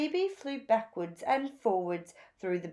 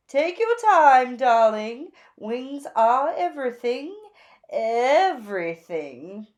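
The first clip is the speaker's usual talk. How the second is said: gushing Zelda; 3 semitones higher, 8 dB louder than usual. A narrator's reading. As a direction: gush over the new look like a flamboyant stylist. Zelda voiced.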